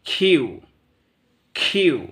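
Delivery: খিউ with an aspirated খ gliding into উ; breathy খ then ইউ for খিউ; British letter Q. Shown as a demonstration. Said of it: "British উচ্চারণে আমাদেরকে বলতে হবে খিউ".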